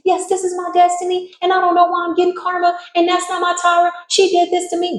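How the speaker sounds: high put-on whining voice; whiny liar voice. Caught liar whines excuses to the court in a high voice.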